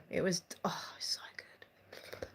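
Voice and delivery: breathy whispered delight. Real, not acted. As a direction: whisper it like a guilty pleasure. Reminiscing about a favourite drink.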